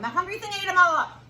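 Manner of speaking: fast, high and loud; excited gobbling. A narrator's reading. Storyteller to children about the eating, fast and excited. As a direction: rattle it off like he gulps it down.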